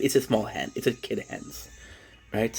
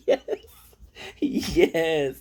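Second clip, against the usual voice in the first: usual speaking voice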